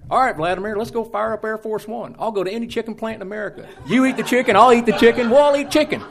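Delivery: high, loud, laughing dare; gleeful challenge. Retold or imitated. Bush throws the challenge back at Vladimir, loud and laughing. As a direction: crank it up and laugh through the challenge. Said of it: "turned the tables on him and says".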